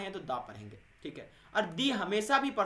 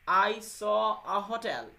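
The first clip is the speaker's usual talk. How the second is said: "I saw a hotel" said very slowly and louder, word by word; example sentence; 3 semitones higher, 6 dB louder, much slower than usual. Shown as a demonstration. Teacher dictates an example sentence slowly to students. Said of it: "जैसे".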